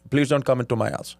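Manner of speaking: imitating an Indian dad's accent; a light Indian accent for her father. [name] imitating Shama's dad in an Indian accent. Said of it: "great Indian accent".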